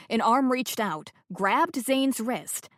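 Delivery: ordinary talk, nothing marked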